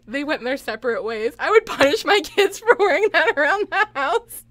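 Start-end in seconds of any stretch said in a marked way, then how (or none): 1.4-4.5 higher and louder, laughing; half outraged, half laughing; laughing outrage. Real, not acted. Streamer laughing at bear suits on kids.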